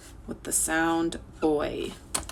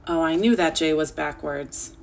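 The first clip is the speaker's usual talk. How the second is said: normal talking voice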